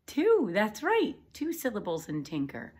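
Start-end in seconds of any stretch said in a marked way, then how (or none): 0.1-1.1 high and loud, slow cheer for the right answer; excited praise. Shown as a demonstration. Teacher cheering the class's right answer, high and loud. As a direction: cheer them on.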